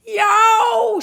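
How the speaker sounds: huge high shout of pain; pained yowl. Acted. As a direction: yelp as teeth break. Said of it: "shouted Cuchulainn".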